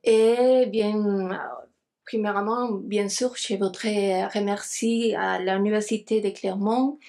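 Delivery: non-native speaker's French accent; careful French with a foreign accent. Real, not acted. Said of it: "mon français, c'est pas très bon".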